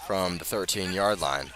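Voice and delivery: usual speaking voice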